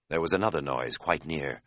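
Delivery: plain reading voice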